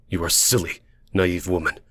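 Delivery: low, contemptuous put-down; scornful. Acted. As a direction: belittle her with controlled anger. Angry man belittles her.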